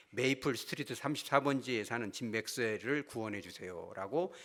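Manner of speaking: low, hushed prayer reading; solemn read-aloud church prayer; reading the elders' prayer. Retold or imitated. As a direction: read it like the elders praying in unison. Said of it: "제가 그대로 읽어드릴게요".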